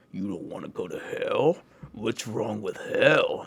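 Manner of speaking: slow, mocking devil taunt with an altered timbre. Dream devil taunting the fleeing kid, slow and mocking. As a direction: drawl it out like a taunting demon. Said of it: "and he's like, laughing".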